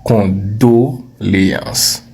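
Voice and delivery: «condoléances» very slowly, syllable by syllable; slow model word. Shown as a demonstration. Teacher models condoléances slowly for the class to repeat. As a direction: stretch out «condoléances» clearly: "con-do-lé-ances". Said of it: "Répète".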